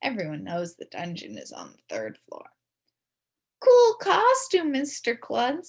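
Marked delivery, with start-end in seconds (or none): 3.6-5.7 high, loud and slow call; yelled. A narrator's reading. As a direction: shout it across the room. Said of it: "some kid yelled".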